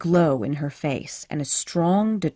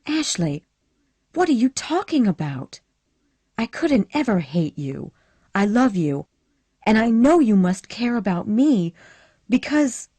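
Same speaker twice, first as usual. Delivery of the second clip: put-on higher and louder, eager young woman begging; pleading Scarlett; 5 semitones higher, 4 dB louder than usual. A narrator's reading. Scarlett begging Ashley to take her seriously, higher pleading voice. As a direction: push her voice up, urgent and pleading.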